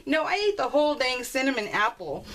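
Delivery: ordinary delivery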